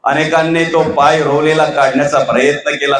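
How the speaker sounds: ordinary talk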